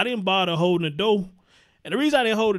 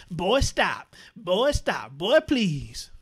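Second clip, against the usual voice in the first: softer, dismissive mock woman's voice, lightly put on; mock coy woman; 3 semitones lower, 4 dB quieter, much slower than usual. A woman coyly waving off a man's compliment, mock coy. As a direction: brush him off like a flattered woman. Imitating a flirting woman brushing off.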